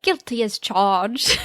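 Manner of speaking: slow, bad British accent attempt; a hammed-up bad British accent; worse British accent. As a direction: try the British accent again and botch it. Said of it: "That was even worse".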